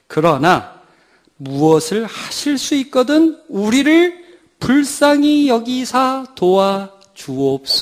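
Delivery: high, slow, pleading father begging for help; desperate father's plea. Acted. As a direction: plead slowly as a father losing faith. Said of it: "그 다음에 뭐라고 그러죠?". (높고 느리게 애원하는 아버지 목소리)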